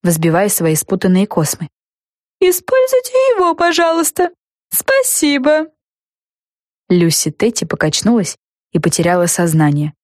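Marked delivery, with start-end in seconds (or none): 2.4-5.7 high, prim insistence; insist, prim and polite; ghost fairy voice. A narrator's reading.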